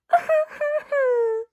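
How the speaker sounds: high, acted sob. A narrator's reading. Mother breaking down, a high acted sob. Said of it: "She began to sob".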